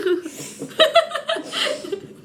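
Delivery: shrill burst of laughter, high; high-pitched laugh. Real, not acted. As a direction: let out a squealing laugh. Laughing at friend's reaction. (rire aigu)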